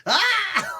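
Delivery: high, loud howl of pain; hammed-up kneecapped shriek; mock agony scream. He's just like.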